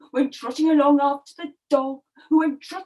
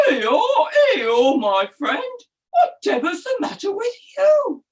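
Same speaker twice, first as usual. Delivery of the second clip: braying greeting into a high question; lively donkey voice, swooping up; sympathetic donkey; 5 semitones higher, 5 dB louder than usual. Acted. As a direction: bray loudly, then ask with concern. Donkey hails the sad cat.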